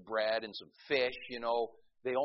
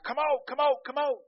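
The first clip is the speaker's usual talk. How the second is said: high shouted come out; imitating a screaming faith healer; mock screaming exorcist; 14 semitones higher, 8 dB louder than usual. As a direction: yell it like a tent-meeting exorcist. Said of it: "he starts screaming at her".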